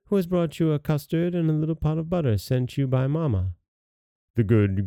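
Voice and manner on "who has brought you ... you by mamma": put-on wolf faking a little girl, higher pitch; counterfeit girl voice. Wolf pretending to bring butter, counterfeit girl voice. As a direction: put on a light, higher child's voice. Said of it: "counterfeiting her voice".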